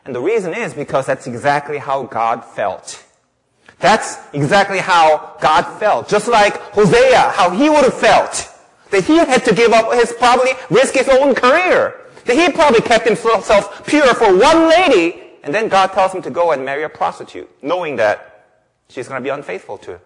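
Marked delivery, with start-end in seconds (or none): 3.8-15.2 loud, high-pitched pulpit fervor; building, emphatic sermon delivery; impassioned preaching. Real, not acted. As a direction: preach it at full force, voice climbing. Preaches at full force.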